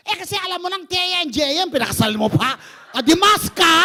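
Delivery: loud, high laughing jab; laughing loud retort. Retold or imitated. Apostle to wife in counseling, laughing loud retort. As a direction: throw the punchline out loud with a laugh.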